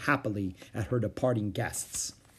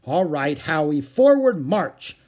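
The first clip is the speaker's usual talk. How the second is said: loud, raised marching command; ordering; 5 semitones higher, 8 dB louder, slower than usual. A narrator's reading. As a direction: call it out, stretching the command. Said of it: "Beezus ordered".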